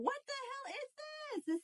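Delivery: breathless high surprise; startled. Real, not acted. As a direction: caught off guard by a sudden chill. Sudden tingles during reading.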